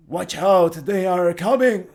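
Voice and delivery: loud, urgent warning shout; Nebulon. Acted. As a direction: call out a warning to the party. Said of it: "Nebulon replies immediately".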